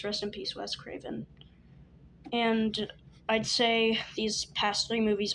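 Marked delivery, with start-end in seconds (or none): 0.0-1.3 hushed. Real, not acted. Paying respects to late director.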